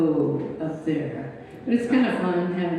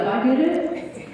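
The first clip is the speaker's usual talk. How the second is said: ordinary talk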